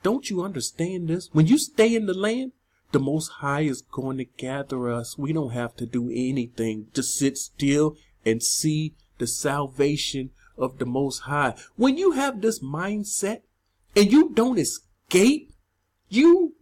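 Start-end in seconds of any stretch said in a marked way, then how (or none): 2.9-11.5 low, quiet monotone, parroting a passive belief; mocking low drone; mock complacent believer. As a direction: drone it softly in a low, even voice, mimicking the complacent. Voicing the complacent mindset he attacks.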